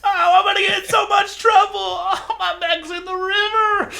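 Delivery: high-pitched, hammed-up crying wail; mock wailing. Pilot with a mech in the river, mock wailing.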